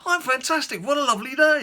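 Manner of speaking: very high, loud, gushing hello; match the over-the-top cheer; worked into a frenzy. Retold or imitated. Courier at the door, in a gushing frenzy. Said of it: "He says".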